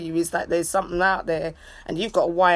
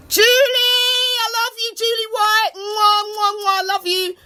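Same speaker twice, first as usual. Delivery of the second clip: excited shout-outs; 12 semitones higher than usual. Real, not acted. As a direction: whoop and cheer for your fans. Greeting viewer with kisses.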